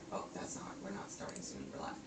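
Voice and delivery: hushed unvoiced whisper. Real, not acted. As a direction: whisper it aside, off mic. Realises stream is live.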